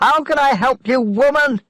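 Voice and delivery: high frantic shout at the woman; strained high yell over the storm. A narrator's reading. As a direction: shout back in despair. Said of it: "Daniel shouted at Missus Dawson".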